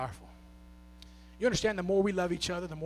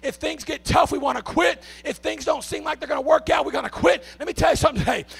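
loud, high, shouting preacher's cry; preaching at full force; 11 semitones higher, 15 dB louder than usual. Acted. Preacher shouting gratitude to his church, full force. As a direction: pour it out over the crowd, full throttle.

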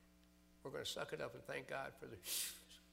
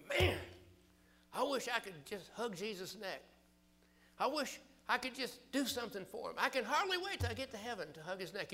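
higher, louder eager churchgoer, a bit fast; eager believer; 7 semitones higher, 7 dB louder than usual. Retold or imitated. Preacher voicing a listener who longs to hug Jesus, eager. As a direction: gush like a longing believer. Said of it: "You say".

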